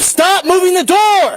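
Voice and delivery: yelling commands at suspect; shouted surrender demands. Real, not acted. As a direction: bark the order at full volume. Officer ordering suspect.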